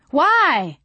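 high, loud wail of protest; yelled. A narrator's reading. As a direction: yell it in dismay. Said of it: "I yelled".

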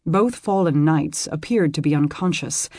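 usual speaking voice

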